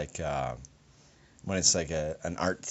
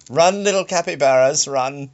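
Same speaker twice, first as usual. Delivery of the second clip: loud, grand theatrical cry; hammy stage actor; 5 semitones higher, 5 dB louder than usual. Acted. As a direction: declaim it like an overacting Shakespearean. Mock Shakespearean cry to capybaras.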